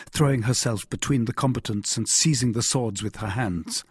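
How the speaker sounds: ordinary delivery, nothing marked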